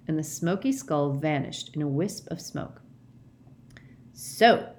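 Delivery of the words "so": loud, gloating pounce; savage triumph. A narrator's reading. Mister Diggory gloating over Winky, savage triumph. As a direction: relish catching her out. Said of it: "with a kind of savage triumph".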